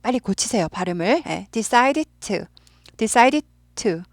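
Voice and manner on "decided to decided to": "decided" clipped short, pause before "to"; staccato. Shown as a demonstration. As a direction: cut "decided" crisply, "to" separate. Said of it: "딱 끊어야 돼요. 스타카토".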